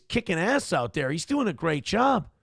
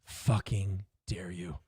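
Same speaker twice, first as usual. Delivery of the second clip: low, slow, pompous indignation; mock indignant senator; 8 semitones lower, 3 dB quieter, slower than usual. Mocking a senator offended by a comeback, low slow indignation. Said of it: "And they're like".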